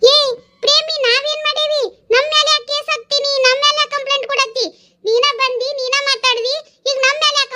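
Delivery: high, protesting neighbour woman; indignant neighbour. Acted. Neighbour protesting to Premi she did nothing, high and offended. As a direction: push back, high and offended.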